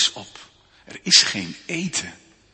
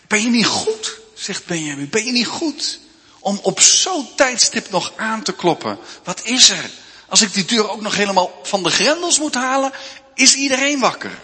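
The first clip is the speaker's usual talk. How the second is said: higher, grumpy rebuke through the door; irritated scolding; 7 semitones higher than usual. Acted. Benjamin scolds Zacharia for knocking at midnight, irritated. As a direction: tell him off for waking you. Woken neighbour scolds the caller.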